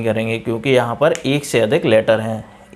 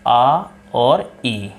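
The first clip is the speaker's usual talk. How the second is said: slow explanation; much slower than usual. Shown as a demonstration. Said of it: "I is equal to आ और ई".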